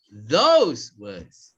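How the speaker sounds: "those" loud, with a swooping pitch; stress on THOSE. Shown as a demonstration. Trainer telling the class to raise volume, stressed "those". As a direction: punch and stretch "those". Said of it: "increasing the volume of your voice".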